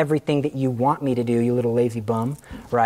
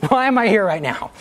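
giggling disbelief at yourself; laughing; 5 semitones higher than usual. Real, not acted. Speaker laughing at own story to the class. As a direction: repeat it higher through a laugh.

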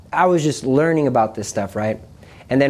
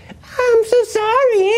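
very high, drawn-out mocking whine; slow, high-pitched sneering wail; creepy demon voice; much slower than usual. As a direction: imitate an ugly, sick demon faking tears. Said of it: "ugly, sick, scary, it creeped me out, voice".

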